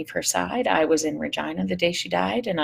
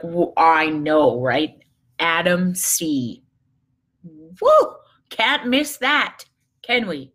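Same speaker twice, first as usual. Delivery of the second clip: sarcastic mock cheer; 4 dB louder, much slower than usual. Mocking her husband's hockey excuse, slow and sarcastic. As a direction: tease him with a fake cheer for hockey.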